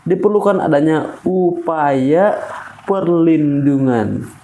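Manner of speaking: "perlindungan" drawn out slowly to land it; slow down and weigh each word; slow, deliberate "diperlukan adanya upaya perlindungan"; slow tempo. Shown as a demonstration. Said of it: "dengan tempo yang cukup pelan".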